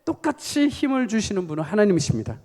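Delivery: loud, high-pitched preacher's proclamation; forceful preaching. Real, not acted. Preacher urging the congregation on about their battle of faith, forceful. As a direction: drive it home to the congregation with force.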